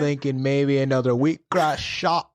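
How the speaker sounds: playing a wheatgrass-sipping LA brunch type; mock wellness LA accent. Friend teasing LA brunch crowd in a put-on LA accent. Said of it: "I can't even do this fucking accent".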